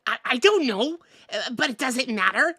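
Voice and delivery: high, loud, determined game-character voice; undeterred adventurer character. Acted. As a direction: declare it like a cartoon hero. Reads game character's lines.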